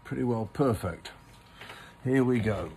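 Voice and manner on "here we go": ordinary delivery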